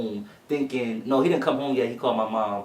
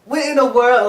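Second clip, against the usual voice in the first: much higher and louder astonished cry; shocked disbelief; 12 semitones higher, 9 dB louder than usual. Retold or imitated. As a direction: react stunned to what you see. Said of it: "I'm just like".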